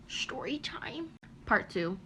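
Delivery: slow, barely-there whisper; hushed storyteller's tease; whispered title tag. Pretend. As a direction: whisper the catchphrase, drawn out.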